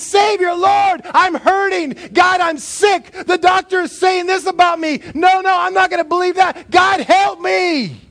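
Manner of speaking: loud, high pleading cries; shouted anguished prayer; desperate cry to God. Acted. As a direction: beg the Master to save you.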